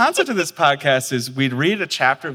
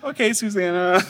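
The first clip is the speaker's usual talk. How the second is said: higher-pitched mock-compliant answer; lightly acted, higher voice; sheepish retold reply; 7 semitones higher than usual. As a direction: play back a meek reply. Voicing own reply to director.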